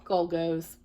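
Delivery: ordinary delivery, nothing marked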